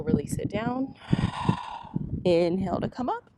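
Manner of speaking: soft, unhurried flow cues; yoga cueing. Acted. Teacher cueing her yoga class, soft and unhurried. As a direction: guide the class through each breath.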